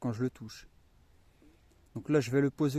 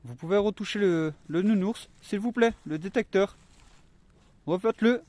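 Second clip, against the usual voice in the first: calling out loud; 7 semitones higher, 9 dB louder than usual. Real, not acted. Calling out to spirits.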